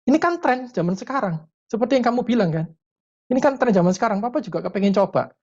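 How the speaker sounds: playful high-pitched retort as Dad; smiling father. Retold or imitated. Dad echoing teenager's excuse, smiling and high-pitched. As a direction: imitate a father teasing back with a smile. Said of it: "Papanya senyum".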